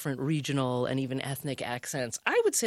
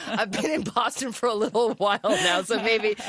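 laughing; 3 semitones higher than usual. Real, not acted. Laughs while admitting Boston.

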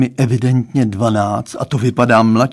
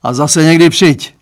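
a lightly raised, fatherly voice; Lucka's father; 4 semitones higher, 6 dB louder than usual. A narrator's reading. Dad confiding to daughter's boyfriend, lightly voiced father. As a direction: play the girl's dad, higher and a bit louder.

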